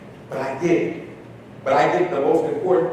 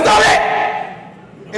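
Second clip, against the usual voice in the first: high, loud sharp "Stop it!"; sudden shout; 5 dB louder than usual. Retold or imitated. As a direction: startle her out of it with a yell. Counselor interrupts student again.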